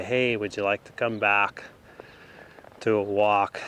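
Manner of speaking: ordinary talk, nothing marked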